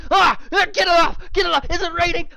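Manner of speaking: high frantic panic, shrieking; panicked shriek. Acted. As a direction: shriek like something's crawling on you. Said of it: "freaking the fuck out".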